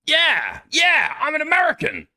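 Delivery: high shouted hammed-up American yell; mock American bravado. British streamer parodying an American, shouted mock bravado. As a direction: bellow it like a cartoon gung-ho American.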